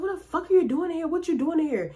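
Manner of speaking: high-pitched, startled demand; shocked. Retold or imitated. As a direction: throw it out high in disbelief. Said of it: "But she was like".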